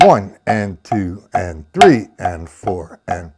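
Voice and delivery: slow, low count with "one" and "three" punched; stress on one and three. Shown as a demonstration. Drum teacher counts along with strokes, stressing "one" and "three". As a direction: lean hard on "one" and "three". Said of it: "the accent on one and three".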